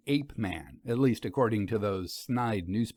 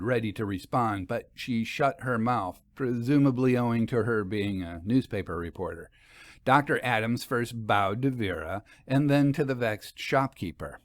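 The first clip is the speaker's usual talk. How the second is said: plain reading voice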